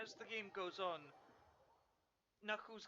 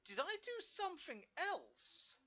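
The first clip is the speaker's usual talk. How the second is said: surprised; 11 semitones higher, slower than usual. Real, not acted. Surprised by game progress.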